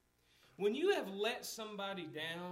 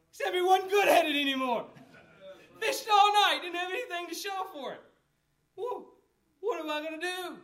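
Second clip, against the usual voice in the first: much higher and louder, building; rising preaching shout; 8 semitones higher, 11 dB louder than usual. Real, not acted. Preacher shouting Peter's empty night to the church. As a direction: belt it out to the congregation.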